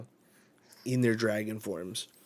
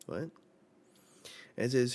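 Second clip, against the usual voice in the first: ordinary delivery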